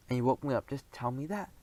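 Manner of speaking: plain reading voice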